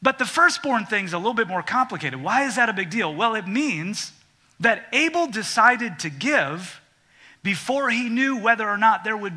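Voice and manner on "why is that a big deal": normal talking voice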